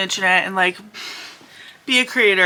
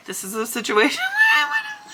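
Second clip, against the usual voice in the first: much higher, laughing mock shout; laughing outburst; faster than usual. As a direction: burst out laughing mid-line. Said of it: "I was like".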